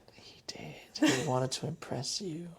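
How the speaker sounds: hushed, choked-up imitation; overcome Irish girlfriend. Girlfriend melting over dressed-up dog, hushed mock-overcome. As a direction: go tiny and soft, gushing over the dog. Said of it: "and she was like".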